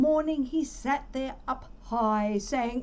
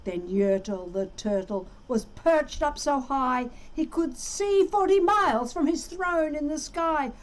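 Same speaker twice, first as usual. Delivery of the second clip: usual speaking voice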